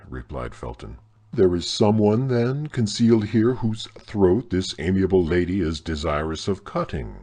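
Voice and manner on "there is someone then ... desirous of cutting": loud, sneering irony; mock the lady with scorn; ironical, contemptuous. A narrator's reading. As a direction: baron scoffing at his prisoner, contemptuous. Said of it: "in an ironical, contemptuous tone".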